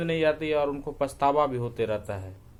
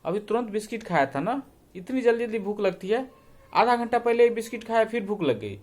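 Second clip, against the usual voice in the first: a higher-pitched nagging mother; scolding mother; 7 semitones higher than usual. Acted. Mother scolding her son for snacking again, exasperated. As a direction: chide the boy for always being hungry.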